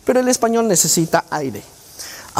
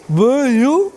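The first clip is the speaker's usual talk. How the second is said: dysarthric patient; 5 semitones higher, 4 dB louder than usual. Retold or imitated. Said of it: "para el paciente disártrico decirte".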